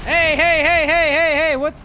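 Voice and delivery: urgent hey hey hey; loud shouting. Real, not acted. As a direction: shout to stop them. Being attacked in game.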